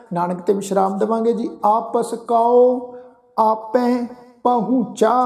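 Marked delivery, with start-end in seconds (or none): 1.6-5.1 slow, drawn-out scripture chant; slow devotional recitation of the verse. Shown as a demonstration. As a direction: recite each word slowly and reverently.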